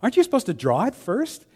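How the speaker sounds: high-pitched, worried question; mock alarm. Tattoo client questions the artist drawing, in mock alarm. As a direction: query it in put-on alarm, pitch jumping up.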